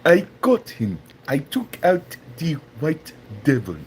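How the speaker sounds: hammed-up African accent, halting boast; mock African accent. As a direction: put on a deliberate African accent. Continues mimicking Chaba's boast.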